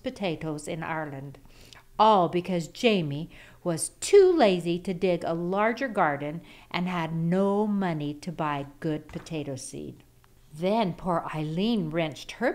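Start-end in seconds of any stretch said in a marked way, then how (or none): none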